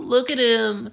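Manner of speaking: high, loud mocking giggle; giggling. Bar friends giggling at the guy, high mocking laughter. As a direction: cackle the words out at someone's expense. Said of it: "like, giggling".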